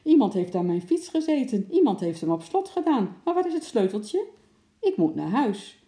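high, loud, agitated customer; indignant complaint. Acted. Woman with a locked bike berates the attendant, indignant and loud. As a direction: complain loudly, pitch leaping up.

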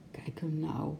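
softly mumbled pity; soft murmur. A narrator's reading. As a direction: murmur it under your breath. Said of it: "mompelde Lotje zacht".

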